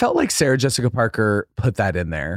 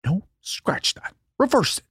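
Wonka line read; 4 dB quieter than usual. Retold or imitated. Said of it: "Timothy Chalamet and Wonka".

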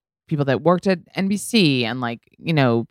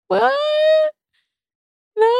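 stunned disbelief. Retold or imitated. Retold stunned, tearful reaction to news.

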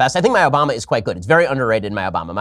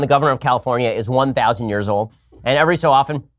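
ordinary talk, nothing marked

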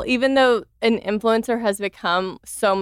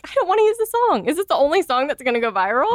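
fast, much higher exasperated burst; frustrated rant; 6 semitones higher, faster than usual. Retold or imitated. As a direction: blurt out annoyance at the same song. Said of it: "And I was like".

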